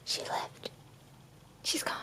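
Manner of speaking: breathy hushed whisper; hushed aside. Real, not acted. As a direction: whisper so the cat won't hear. Hiding from kitten, whispering.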